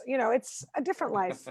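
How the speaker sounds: ordinary delivery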